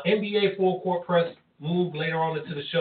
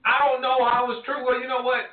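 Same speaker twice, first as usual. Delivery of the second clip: exasperated; 8 semitones higher than usual. Real, not acted. Host exasperated by teasing.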